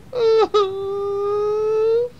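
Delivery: long high wail; boo-hoo loudly in a high voice; mock crying howl; hammed-up sobbing. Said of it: "crying and crying and crying".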